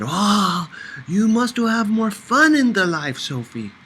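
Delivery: put-on high, loud, teasing older woman with an accent; Dika's accented voice. A narrator's reading. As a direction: chide her playfully for being no fun.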